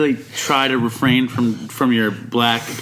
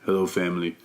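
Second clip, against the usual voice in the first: low, soft, halting testimony; hushed low voice, pausing between phrases; man at the meeting; 6 semitones lower, 5 dB quieter than usual. Retold or imitated. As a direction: imitate a quiet, deep-voiced meeting member.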